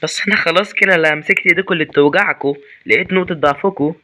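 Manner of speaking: loud triumphant taunt; gloating boast. Real, not acted. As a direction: crow over the enemies. Gloats at enemies.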